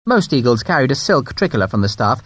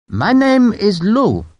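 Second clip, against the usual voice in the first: higher, slow, courteous introduction; French brigadier; 8 semitones higher, much slower than usual. Acted. The brigadier introducing himself to Sharp, slow and mild. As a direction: announce yourself slowly and courteously.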